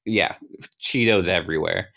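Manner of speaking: plain reading voice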